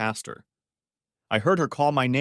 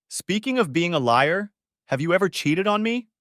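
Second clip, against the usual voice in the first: raised, higher accusing voice; angry; 8 semitones higher, 5 dB louder than usual. Acted. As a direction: demand it, voice climbing.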